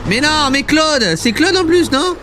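very high, slow indignant cry; outraged disbelief. Real, not acted. Outraged at rival racer Claude. (indignation suraiguë)

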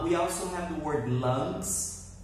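ordinary delivery

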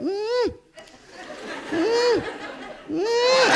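high, soft humming; soft high mm sounds; mock hum. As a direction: hum like the thing at the window. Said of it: "and it went".

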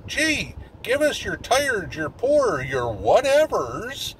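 high, drawn-out sarcastic plea; mocking quote. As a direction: mimic naive generosity, slowly and high. Mocking quote of leftists in charge.

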